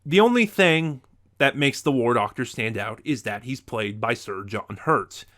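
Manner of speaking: ordinary talk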